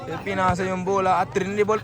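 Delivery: ordinary talk, nothing marked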